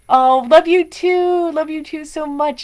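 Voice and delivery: reply to a viewer's love, gushing; gushing thanks. Real, not acted. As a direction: gush back at a fan.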